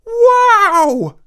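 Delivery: very high, loud amazed wow. A narrator's reading. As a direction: gasp it out in wonder. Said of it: "they both said in unison".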